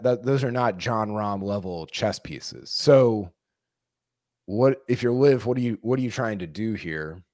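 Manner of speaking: ordinary talk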